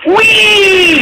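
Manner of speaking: high joyful whee; gleeful cry. A narrator's reading. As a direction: squeal with delight. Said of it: "she cried".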